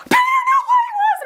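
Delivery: very high fangirl squeal; hammed-up, retold high-pitched freak-out.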